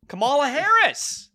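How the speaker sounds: loud, high, drawn-out name; theatrical name call. Pretend. Calling out the figure's name theatrically.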